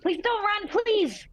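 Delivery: high, loud desperate plea; frantic high cry to stop a running friend; pleading shout. Acted. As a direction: yell it after her, begging. Character begs friend not to run.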